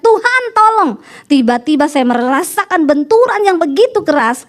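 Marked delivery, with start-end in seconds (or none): 0.0-0.9 high retold cry to God; mild retold yell; retold cry for help. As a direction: call out for help, pitch raised. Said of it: "dengar suami saya teriak".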